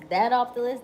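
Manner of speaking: cross it off with gusto; emphatic. Acted. Repeated line, two deliveries.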